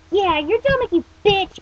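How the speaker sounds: high, loud taunt; gleeful cry. Acted. Creature taunts the teen from a shoulder, high loud cry. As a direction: crow it mockingly. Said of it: "Mokono cried".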